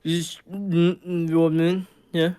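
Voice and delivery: halting, mumbled non-words; garbled first words. Retold or imitated. Speaker failing the first words of a conversation, garbled mumble. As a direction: fumble through half-formed words with gaps. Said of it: "be me going like this".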